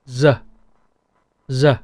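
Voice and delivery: "Z" buzzed like zoo; Northern Vietnamese "Z", voiced buzz; Northern Z sound. Shown as a demonstration. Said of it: "The Northern will pronounce this as".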